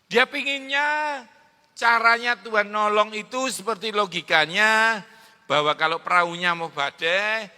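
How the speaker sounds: loud, building preacher's voice; rousing sermon delivery; full-force preaching. Acted. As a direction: proclaim it to the hall.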